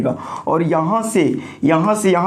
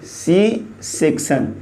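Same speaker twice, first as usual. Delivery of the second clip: slow repeat for dictation; much slower than usual. Shown as a demonstration. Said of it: "और एक होता है सी section".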